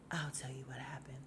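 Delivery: low whispery impression of Tommy; mock a man who talks in a whisper; odd hushed voice. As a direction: drop into a hushed, low murmur, imitating him. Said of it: "Why does he talk like that?".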